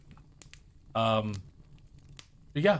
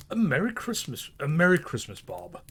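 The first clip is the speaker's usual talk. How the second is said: higher, heartfelt Christmas greeting; earnest warmth; 3 semitones higher than usual. A narrator's reading. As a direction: wish it with full sincerity. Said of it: "with an earnestness that could not be mistaken".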